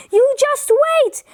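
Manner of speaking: high mock yell; storybook shout without real volume; yelled threat. A narrator's reading. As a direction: call a warning after a runaway, pitched right up. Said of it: "yelled the man".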